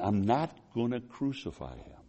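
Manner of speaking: soft, measured refusal as Pilate; quiet refusal. Acted. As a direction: refuse firmly without raising the voice. Pilate's refusal, acted softly.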